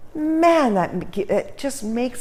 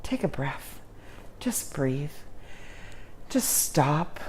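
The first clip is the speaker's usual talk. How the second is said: much softer, easing right down; soft calming breath cue; calm and hushed; 5 semitones lower, 10 dB quieter than usual. Shown as a demonstration. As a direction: let the voice drop away to calm them. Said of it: "just calm down".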